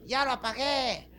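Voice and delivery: put-on high, drawn-out child's voice; little boy. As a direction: play a tiny fireman at work. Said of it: "se bajaba el pinche escuincle".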